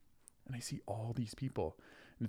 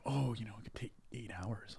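unvoiced hushed imitation of others' warnings; whispered quote; 4 dB quieter than usual. Host retelling the crowd's rumour, a mock whisper. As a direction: pass it on as a secretive whisper. Retelling what people kept saying.

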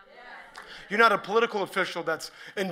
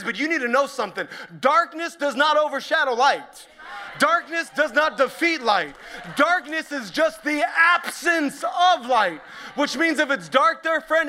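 high, loud, driving sermon delivery; preaching at full force; 6 semitones higher, 5 dB louder than usual. Real, not acted. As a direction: build into a ringing, urgent proclamation. Preacher builds to a climax.

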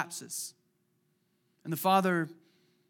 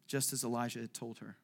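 usual speaking voice

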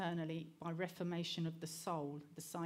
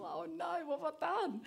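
much higher, mock-horrified self-reproach; dismayed realization; 11 semitones higher than usual. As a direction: gasp at your own mistake. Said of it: "I thought".

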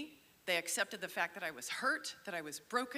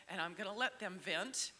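ordinary delivery

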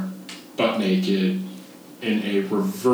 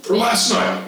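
mock-official director. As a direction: play the stern official reporting to a parent. Director speaks to mother on phone.

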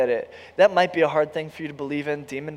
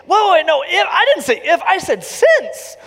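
much higher, louder insistent protest with a laugh; eager correction; 11 semitones higher, 7 dB louder than usual. Pretend. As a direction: correct him fast, almost shouting. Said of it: "I'm gonna say".

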